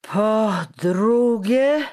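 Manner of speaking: very slow, high, loud bellow; drawn-out furious shout. A narrator's reading. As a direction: stretch each syllable into an angry yell. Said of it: "krzyknął czekan w nabrzmiałej ręce ściskając".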